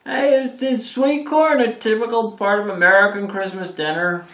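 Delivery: high, loud dumb-guy voice; hammed-up dumb tourist voice; in character as himself. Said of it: "get into character".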